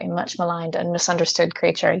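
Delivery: ordinary talk, nothing marked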